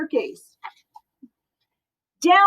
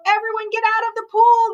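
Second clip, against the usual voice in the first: yelled; 8 semitones higher, faster than usual. Acted. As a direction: shout the swimmers out of the pool. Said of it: "The firemen yelled".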